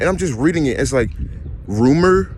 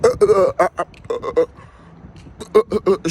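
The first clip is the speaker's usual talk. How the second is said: mock stutter; 6 semitones higher than usual. Mocking her stuttering.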